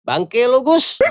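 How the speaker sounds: high, loud outburst; exasperated cursing. Acted. Udin realizing Agus fooled him, exasperated shout. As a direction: curse him in a high-pitched yell. Udin curses prank-playing friend.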